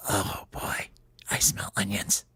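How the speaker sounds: soft, breathy near-whisper; secretive little whisper of delight; hushed anticipation. Acted. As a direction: whisper it greedily, catching a scent. Homie sniffs out onions.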